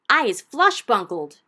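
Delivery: roaring giant. Acted. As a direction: roar it out like a trussed-up giant. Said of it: "roared the flesh lump eater".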